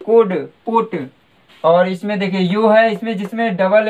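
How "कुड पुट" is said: कुड and पुट slowly with a short oo; teacher slowing down to show the short oo; modelling short oo. Shown as a demonstration. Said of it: "छोटा ऊ का sound आएगा".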